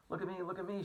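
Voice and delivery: high, loud excited cry; a bug showing off, high and loud; excited shout. A narrator's reading. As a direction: call out thrilled to be free. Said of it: "shouted the centipede excitedly".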